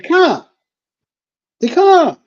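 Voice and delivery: high, insistent Boston "car"; Boston accent. Retold or imitated. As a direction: repeat it like an impatient Bostonian. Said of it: "She's like".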